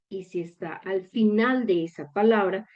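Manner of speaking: usual speaking voice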